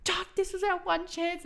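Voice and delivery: high-pitched retold reproach; mock exasperation. Retold desperate plea in dream.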